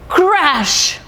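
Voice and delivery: high exclaimed crash; dramatic crash. Acted. Storyteller to children, exclaiming the crash high. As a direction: burst out with the crash.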